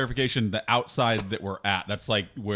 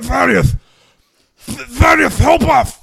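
high, loud sputtering cry for help; spray the words as you yell; spitty marshmallow cry; 13 semitones higher, 13 dB louder, slower than usual. Acted. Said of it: "Say it, don't spray it".

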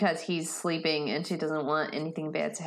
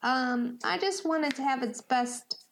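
put-on lightly raised, earnest young Benny; a gentle, hopeful character voice, a bit higher; Benny's voice; 8 semitones higher than usual.

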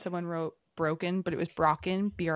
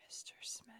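whisper; 20 dB quieter than usual. Real, not acted. Whispering about a teacher.

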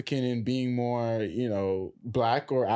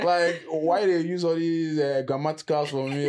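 put-on Nigerian accent. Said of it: "if I use this current accent".

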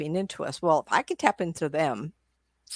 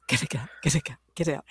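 dog's urgent plea; 3 semitones lower, much faster than usual. Acted. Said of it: "the little guy was going".

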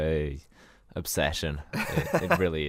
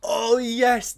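excited; 13 semitones higher than usual. Real, not acted. Sudden excited realization.

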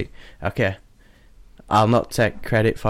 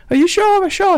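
anxious woman; 4 dB louder than usual. Acted. Woman voiced in story.

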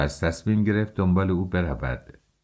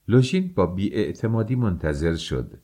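ordinary delivery, nothing marked

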